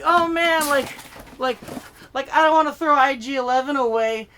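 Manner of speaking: high, loud mock protest; reluctant hoarder outburst. Hoarder refusing to throw a toy away, mock protest. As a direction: protest loudly as a toy owner.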